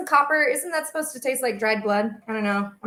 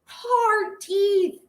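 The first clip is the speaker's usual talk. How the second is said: squeaky high-pitched imitation of herself, much higher; mock high voice; 12 semitones higher, slower than usual. Nanny answering the mom about teething, in a squeaky high mock voice. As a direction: pipe it up high like a cartoon. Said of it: "I was just like".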